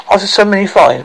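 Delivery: ordinary delivery, nothing marked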